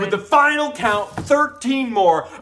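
high, excited final tally; elated, high-pitched declaration; triumphant announcement. Real, not acted. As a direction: announce the result like a big win. Announcing final count and possible win.

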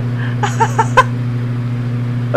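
high, breathy nervous laugh; laughing nervously after a jump scare. Real, not acted. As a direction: laugh off the fright.

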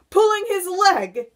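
high, loud, delighted outburst; gleeful cry. A narrator's reading. Centipede mocking the earthworm, high gleeful cry. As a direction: cry out in hysterical glee. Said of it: "he cried, wriggling with glee".

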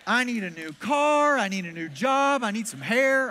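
imitating an eager friend's wish list; loud, stretched-out punch on each item; eager demanding. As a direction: rattle off wishes in a high, loud voice.

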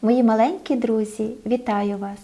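plain reading voice

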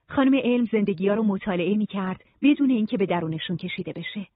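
ordinary delivery, nothing marked

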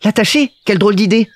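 put-on high, taken-aback little-boy voice; shocked Petit Prince. A narrator's reading. Little prince is shocked at tying up his sheep, high exclamation. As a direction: exclaim at an absurd idea like a child. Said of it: "parut choquer le petit prince".

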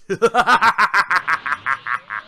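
long smug evil chuckle; villain chuckle. Acted. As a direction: laugh like a gloating brute. Broly villain laugh.

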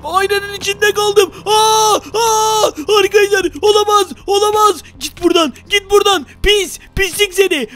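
scared gamer yelling at the monster; panicked wail. Real, not acted. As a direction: cry out and chase it off. Trapped, crying out at monster.

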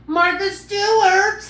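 high, loud, drawn-out slow announcement of the name; show-host call. Pretend. Host hyping her cooking persona to viewers, high slow loud call. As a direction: belt it like a TV host introducing the star.

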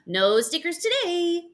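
high, slow singsong announcement; cheery nurse. Acted. Nurse telling the boy about stickers, in a high singsong. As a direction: break it brightly to a small patient.

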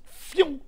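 high, loud "phew" burst; startled whoosh. Retold or imitated. Repeats his reaction to [name].

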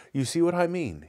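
dubious. Acted. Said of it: "he asked dubiously".

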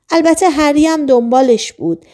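ordinary delivery, nothing marked

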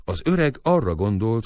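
ordinary delivery, nothing marked

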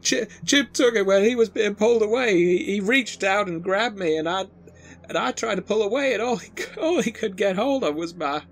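high-pitched, frantic screaming victim; hysterical panic. Acted. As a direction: relive the attack in a high, panicked wail. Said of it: "then she starts screaming".

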